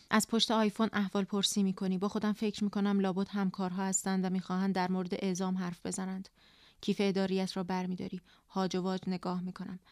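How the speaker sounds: plain reading voice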